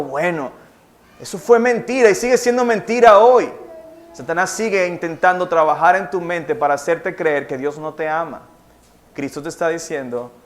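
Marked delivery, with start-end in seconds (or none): none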